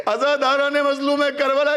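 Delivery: lamenting preacher's cry. Acted. Preacher calls mourners into the Karbala lament, wailing high. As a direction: lift the address into a keening chant.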